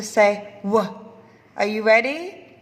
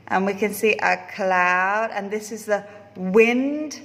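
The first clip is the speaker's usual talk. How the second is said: ordinary delivery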